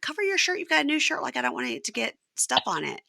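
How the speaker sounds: high, fussy nagging wife voice. Retold or imitated. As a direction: fuss over his shirt like a mother. Wife nagging about shirt, voiced.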